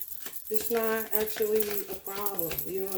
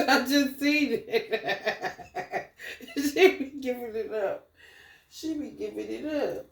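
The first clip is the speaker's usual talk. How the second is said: laughing; 2 semitones higher, slower than usual. Real, not acted. Laughing at cat with mouse.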